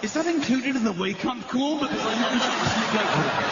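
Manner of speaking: barely holding back laughter at the joke; laughing disbelief. Retold or imitated. Comedian mocking the receptionist's offer, laughing through it. Incredulous mock reply, laughing.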